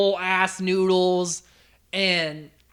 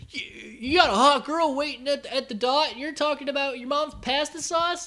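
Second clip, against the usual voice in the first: high-pitched teen drama imitation; lightly mocking high voice; Marco; 8 semitones higher than usual. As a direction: quote a TV character, pitched well up. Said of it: "Marco came out to Spinner".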